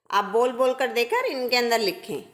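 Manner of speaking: usual speaking voice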